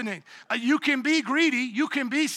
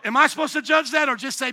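normal talking voice

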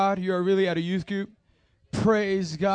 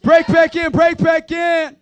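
loud call; 6 semitones higher, 8 dB louder than usual. Real, not acted. Calling the group back together.

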